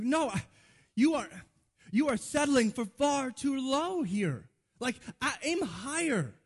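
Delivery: high, sputtering acted protest; exasperated correction. Acted scolding of friend's low wishes.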